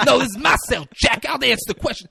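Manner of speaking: loud, high acted comeback; angry retort. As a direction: assert your turf in mock anger. Retold prisoner snaps back in anger.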